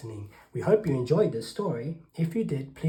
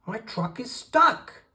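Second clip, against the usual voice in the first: loud, high angry duck; raised, cross storybook voice; snapped; 7 semitones higher, 5 dB louder than usual. A narrator's reading. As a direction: snap it as a frustrated duck. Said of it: "he snapped".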